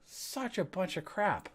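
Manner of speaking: ordinary delivery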